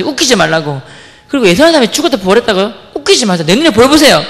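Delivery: high, loud indignant yelling at the teacher; a student yelling back at the preacher; retold angry outburst. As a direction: shout her down in outrage. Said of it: "과함 지른 거예요".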